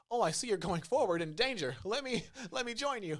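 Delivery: high, eager, silly voice; dumb AI companion. Retold or imitated. Escort AI joining the player, eager high silly voice. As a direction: play the clueless helper cheerfully. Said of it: "And they're like".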